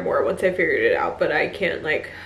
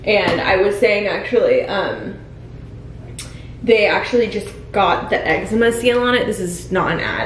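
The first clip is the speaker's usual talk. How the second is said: upbeat ad read, louder; product pitch; 5 dB louder than usual. Acted. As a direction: sell it like a beauty ad.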